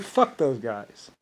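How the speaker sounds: low, soft, slow contemptuous aside; muttered contempt. Retold or imitated. About the enemy fighters, slow muttered contempt. Quoted dismissive thought.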